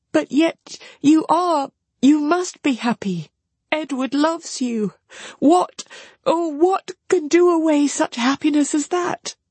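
put-on high, impassioned young woman's voice; high pleading building in pitch; distraught sister. A narrator's reading. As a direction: insist feelingly, pitched well up. Marianne pleads with her sister.

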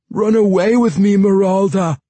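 loud, high desperate yell. A narrator's reading. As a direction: yell it across the garden. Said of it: "Jacka yelled".

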